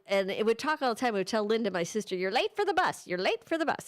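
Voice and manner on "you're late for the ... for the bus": squawky mock parrot, a bit higher. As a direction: call it out like a pet parrot. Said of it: "It would yell".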